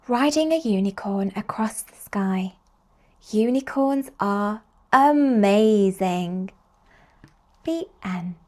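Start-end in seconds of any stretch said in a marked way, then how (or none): none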